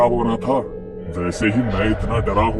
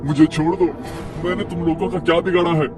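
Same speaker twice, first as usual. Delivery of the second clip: higher, frantic begging voice; scared pleading; 5 semitones higher than usual. Acted. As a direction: beg for your life. Gopal begs the ghost.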